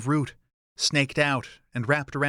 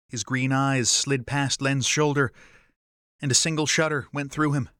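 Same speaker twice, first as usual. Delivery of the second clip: ordinary delivery, nothing marked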